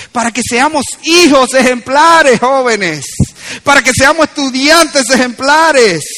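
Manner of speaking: ringing pulpit insistence; forceful preaching. Real, not acted. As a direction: stretch the key words with conviction.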